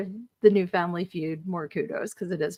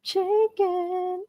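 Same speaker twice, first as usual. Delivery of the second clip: high, sing-song proud "Chicken."; confidently wrong contestant; 11 semitones higher, 3 dB quieter than usual. Retold or imitated. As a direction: chirp it out, brimming with confidence. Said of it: "Her whole little dance was".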